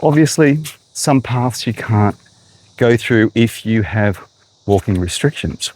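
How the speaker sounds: ordinary talk, nothing marked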